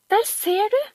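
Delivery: high, triumphant cry; girl proving she was right, high and triumphant; triumphant shout. Acted. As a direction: crow it like you've won. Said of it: "ropte Camilla triumferende".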